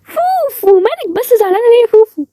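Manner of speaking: very high, drawn-out cooing; cooing concern. Real, not acted. Asking Fufu why she is upset, high-pitched cooing concern.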